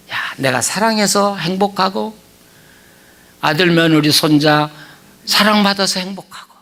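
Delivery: ordinary talk, nothing marked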